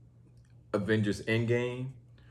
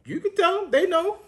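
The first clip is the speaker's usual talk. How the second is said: very high, playful singsong; squeaky high tease; 5 dB louder than usual. Real, not acted. Teasing about telling parents.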